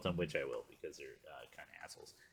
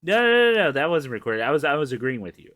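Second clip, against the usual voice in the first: excited protest; 8 semitones higher, 12 dB louder than usual. Real, not acted. Laughing denial to co-host.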